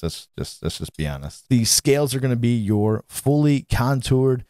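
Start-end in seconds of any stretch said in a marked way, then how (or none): 0.0-1.5 quiet confiding. Real, not acted. Confiding aside.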